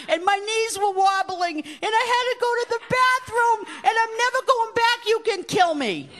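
put-on high, loud, desperate little girl's wail; a terrified child begging her father, loud and high; child's frantic pleading. Little girl clinging to her dad, wailing high and loud.

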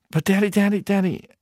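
put-on high whiny little boy; child pleading. Son pleading with his father again, high little boy voice. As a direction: beg in a small boy's voice.

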